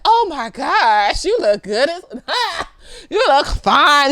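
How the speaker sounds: slow, stretched, laughing compliments; gushing praise. Retold or imitated. Complimenting a friend's outfit, slow laughing gush. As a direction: lay the praise on thick and slow. Gushing over clothes she likes.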